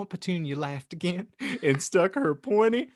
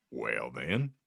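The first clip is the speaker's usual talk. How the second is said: much lower, soft giant voice; calm Hagrid; 5 dB quieter than usual. Acted. Hagrid challenging the aunt, low calm voice. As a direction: say it low and steady.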